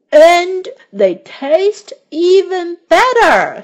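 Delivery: delighted by the taste; excited. A narrator's reading. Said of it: "he said".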